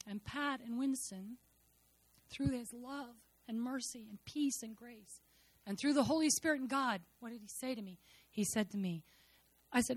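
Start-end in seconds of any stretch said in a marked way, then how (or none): none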